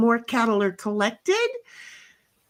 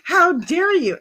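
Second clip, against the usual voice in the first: mock outrage; 6 semitones higher, 4 dB louder than usual.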